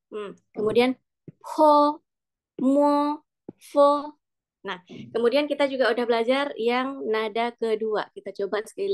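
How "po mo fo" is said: "po", "mo", "fo" high and level; first-tone drill. Shown as a demonstration. Teacher drilling O syllables, high level tone. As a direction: hold each syllable on one high note. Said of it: "O dengan nada satu".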